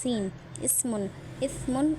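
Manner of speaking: اسم with a sharp hissing س between the teeth; اسم with a clear hissing sibilant; teeth-pressed seen. Shown as a demonstration. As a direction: say اسم with a crisp س sound. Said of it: "مطلب، ایسے پڑھتے ہیں".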